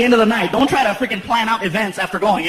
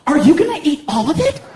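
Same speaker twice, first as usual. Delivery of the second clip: higher, louder suspicious question; girlfriend voice; 6 semitones higher, 4 dB louder than usual. Retold or imitated. Girlfriend grilling him about the cakes, higher and suspicious. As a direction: raise the voice for the girlfriend.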